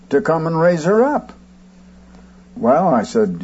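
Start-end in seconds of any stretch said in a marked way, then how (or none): none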